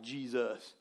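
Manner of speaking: « Jesus. » dit bas et doux, sans surprise; reconnaît tranquillement « Jesus. »; calm recognition. Retold or imitated. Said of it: "they were not like".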